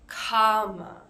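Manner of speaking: "Comma." with "com" stressed and a weak schwa at the end; "Comma." stressed up front, schwa ending; stress on first syllable. Shown as a demonstration. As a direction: hit "com", let the final "a" fall away. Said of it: "So com, that's the part that is stressed".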